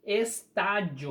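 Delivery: estádio slowed, with TÁ held long; es-TÁ-dio, lean on "tá"; slow demo of the strong syllable in "Estádio"; stress on TÁ. Shown as a demonstration. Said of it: "antepenúltima sílaba é tônica".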